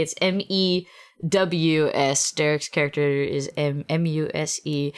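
letters M E W S spelled one by one; spelling it out. Shown as a demonstration. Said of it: "spelled a little differently".

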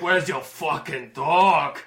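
slow, drawn-out demand at normal pitch; gruff demand. Acted. Angry rescuer walks over to Ronaldo, slow stern demand. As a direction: demand it slowly, stretching the last word. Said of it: "he walks over to Ronaldo and he's like".

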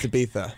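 "Ibiza" said with a th for the z; European pronunciation. Shown as a demonstration. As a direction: say "Ibiza" as ih-BEE-tha. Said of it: "in Europe, it's pronounced Ibiza".

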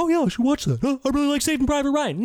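high, eager naive moviegoer; mock clueless enthusiasm; naive viewer voice. Imitates naive people replying.

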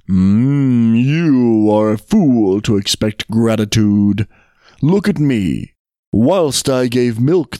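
slow, drawn-out animal grumble; weary buffalo. Acted. Buffalo bemoans its poor treatment, slow and aggrieved. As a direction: open on a long hum, then complain heavily.

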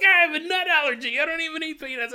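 high, fast mock complaint; a caged monster whining about allergies; whiny werewolf.

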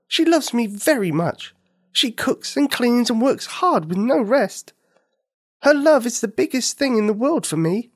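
put-on high, earnest little girl; a child explaining why her mother deserves the best. Nikini tells the mynah bird.

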